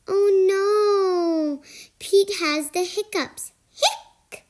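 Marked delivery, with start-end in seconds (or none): 3.7-4.4 breathy little hic; a small voiceless hiccup; hic sound. Acted.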